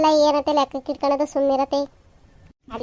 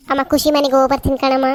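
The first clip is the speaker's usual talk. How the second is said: normal talking voice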